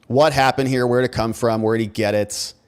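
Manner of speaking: ordinary talk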